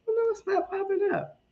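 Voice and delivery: high, squealing disappointed whine. Real, not acted. Disappointed squeal at tech problem.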